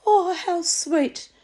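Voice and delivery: high, slow, sugary coo of a passing lady. Retold or imitated. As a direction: coo it like a charmed stranger. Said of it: "this lady in the small dog park went".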